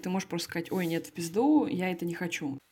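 ordinary talk

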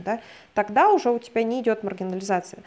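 normal talking voice

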